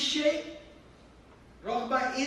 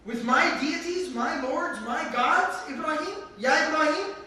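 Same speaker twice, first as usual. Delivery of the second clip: higher, louder incredulous questioning; outraged father; 5 semitones higher, 4 dB louder than usual. Acted. Father interrogating his son about his gods, outraged. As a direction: press him, building in outrage.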